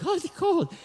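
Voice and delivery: high mock whine about the cold; lightly voiced wimpy local complaining; wimpy shiver. Mock wimpy San Diegan reaction.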